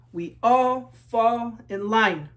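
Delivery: slow, even, marching chant; slow refrain. A narrator's reading. Refrain of the marching class, slow chant. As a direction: drag it out in step like a marching line.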